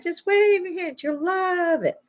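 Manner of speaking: high, sing-song pitch; mock sales pitch. Mimicking the chip sellers, high sing-song pitch. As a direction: sell it with fake cheer.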